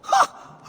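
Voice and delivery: one sharp high scoffing ha; a loud, dismissive bark of a laugh. Acted. Poet scoffs at the accusation, sharp high ha.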